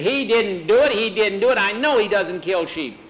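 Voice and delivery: put-on high, loud boyish insistence; boy's protest. Boy defending his dog against the accusation, high protesting.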